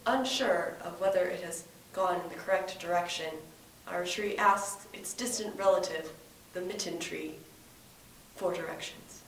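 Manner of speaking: hushed wildlife documentary narrator; nature documentary. Acted.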